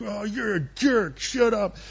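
high, drawn-out hammed-up insult; mock outrage. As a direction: play an indignant king lashing out. King's outburst in a retelling.

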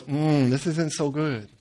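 low, slow, soft hesitation; reluctant bad news. Joseph reading the baker's dream, reluctant and subdued. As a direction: break it gently, with a wincing pause.

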